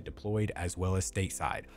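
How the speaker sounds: ordinary talk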